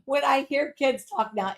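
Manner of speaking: normal talking voice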